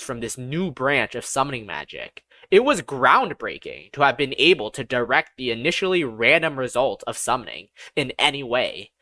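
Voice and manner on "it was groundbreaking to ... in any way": ordinary delivery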